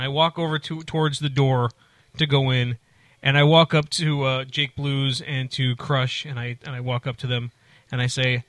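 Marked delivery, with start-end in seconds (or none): none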